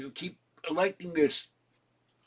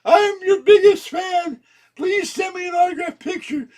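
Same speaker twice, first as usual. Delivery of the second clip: much higher, louder shouted gushing; mock fawning fan; 10 dB louder than usual. Pretend fan shouting to Pelosi for an autograph, gushing. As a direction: ham up a gushing superfan. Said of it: "you should ask Pelosi".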